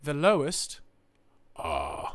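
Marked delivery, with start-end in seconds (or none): 1.6-2.1 very low, deep "uh"; low register. Shown as a demonstration. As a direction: drop to the bottom of your range. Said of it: "is the low register".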